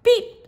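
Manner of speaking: a bright high little horn beep. Acted. Little truck greeting the toad. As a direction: toot it high and quick. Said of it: "said Blue".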